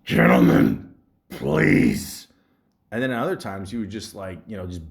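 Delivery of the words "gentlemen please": much lower, loud, heavy voice; deep stern teacher voice. Retold or imitated. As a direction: boom it out like a menacing teacher. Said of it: "he'd be like".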